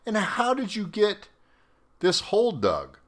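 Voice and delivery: high, strained voice of a baffled officer; incredulous commander. Retold or imitated. Commander asking how the hole got dug, high and disbelieving. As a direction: push the pitch way up in disbelief. Commander questions the pool-building soldier.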